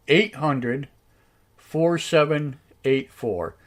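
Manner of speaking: slow, digit by digit with pauses; slow dictation. Real, not acted. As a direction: read out a number for someone writing it down. Dictating phone number to be jotted down.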